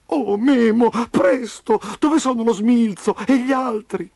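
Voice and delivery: put-on high, wailing child's voice; distressed pleading. A narrator's reading. Trapped Roby asking after the others, distressed. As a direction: urge him on in a high, anxious cry.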